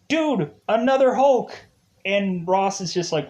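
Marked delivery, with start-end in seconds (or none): 0.1-1.5 high, punchy mock exclamation; mock alarmed insistence. Soldiers telling Ross about another Hulk, high mock alarm. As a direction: insist in comic disbelief, pitched high.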